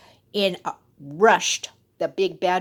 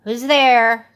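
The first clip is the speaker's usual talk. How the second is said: put-on kids calling out; 2 semitones higher, 8 dB louder than usual. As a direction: call out like small goats. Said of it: "cried the seven little kids".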